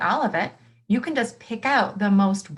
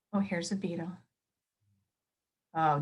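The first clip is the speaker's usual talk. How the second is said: usual speaking voice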